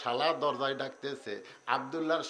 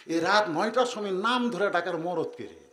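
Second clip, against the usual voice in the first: usual speaking voice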